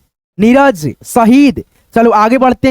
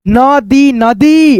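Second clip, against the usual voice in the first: नदी नदी, each syllable drawn out slowly; slow clear reading; much slower than usual. Shown as a demonstration. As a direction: stretch नदी out slowly for beginners. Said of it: "ऐसे बोलना". (धीरे धीरे पढ़ना)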